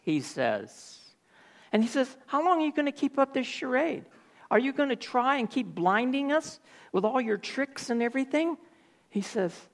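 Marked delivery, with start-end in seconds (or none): 2.2-8.6 much higher, fast mocking challenge; indignant accusation. Dathan accusing Moses of tricks, high and fast. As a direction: fire it at Moses like a sneer.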